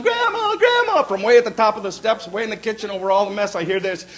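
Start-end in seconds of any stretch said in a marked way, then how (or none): 0.0-1.0 put-on yelling kids. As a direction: shout it like excited grandsons. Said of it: "All four kids are yelling".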